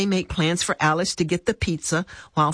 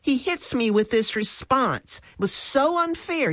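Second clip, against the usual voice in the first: higher-pitched aggrieved retelling; indignant complaint; 9 semitones higher than usual. A narrator's reading. Fran complains to Jesus about the response, indignant. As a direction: protest at being treated unfairly.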